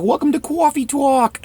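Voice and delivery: high, loud New York "cawfee tawk"; New York accent. Acted. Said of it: "It's a New York accent".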